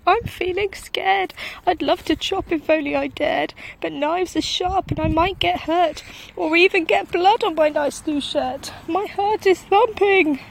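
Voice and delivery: high, loud trembling cook voice; scared cook. A narrator's reading. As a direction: babble your fears like a terrified wimp. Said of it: "the cook declared".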